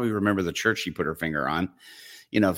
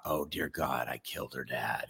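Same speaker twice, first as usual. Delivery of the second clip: dread; 6 semitones lower, 10 dB quieter than usual. Retold or imitated. Fearing the father died.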